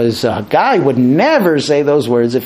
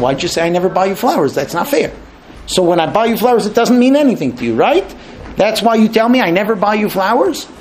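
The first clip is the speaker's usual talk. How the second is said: fast, rising higher and higher in protest; indignant husband; 5 semitones higher, faster than usual. Retold or imitated. Husband challenges wife about flowers, rising indignation. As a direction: argue back, hurt and quick. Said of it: "you look at her and say".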